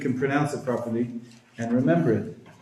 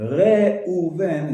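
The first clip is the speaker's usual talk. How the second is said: Reuven said slowly as two syllables; teacher modelling Reuven slowly; slow model word; 4 semitones higher than usual. Shown as a demonstration. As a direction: draw out Reuven for the learner. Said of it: "is actually correct".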